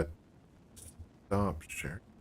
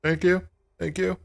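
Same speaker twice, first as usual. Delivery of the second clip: high, loud, cheery mock thanks; smug winner; 11 semitones higher, 3 dB louder than usual. Streamer voicing the winner walking up, high mock thanks. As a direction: play the gracious winner at the front. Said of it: "He's like".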